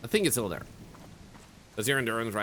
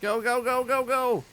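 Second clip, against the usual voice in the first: high, urgent cheering; excited urging; 12 semitones higher, 4 dB louder than usual. Real, not acted. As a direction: push it on, high and eager. Urging the car on.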